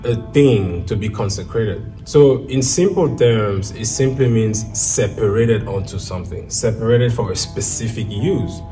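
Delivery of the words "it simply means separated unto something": ordinary talk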